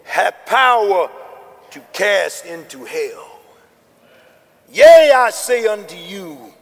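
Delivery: slow, loud preacher's cry; slow, swelling pulpit delivery; preaching. Acted. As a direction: preach it to the congregation. Preaching at full force.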